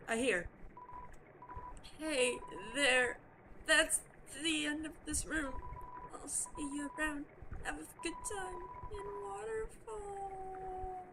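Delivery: lightly voiced gentle sea creature, a little sad; shy Onion-san voice. Cheerful Onion-san greeting the player. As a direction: play the lonely onion, wistful then brave.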